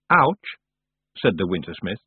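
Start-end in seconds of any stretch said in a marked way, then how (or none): 0.1-0.5 high, loud, put-on ouch; unconvincing cry of pain; belated ouch. A narrator's reading. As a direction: say ouch as an afterthought. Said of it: "as if he'd just remembered to say it".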